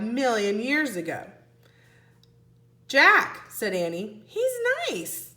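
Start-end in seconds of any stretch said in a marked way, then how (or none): 2.9-3.5 high, loud call. Acted. Annie calling her brother, loud and high. 4.3-5.3 high, gentle voice; sweet girl. Acted. Annie telling Jack about the pterodon, high and sweet.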